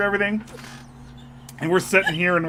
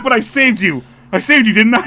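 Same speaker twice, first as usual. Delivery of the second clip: proud retold plea; 4 semitones higher, 8 dB louder than usual. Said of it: "I was like".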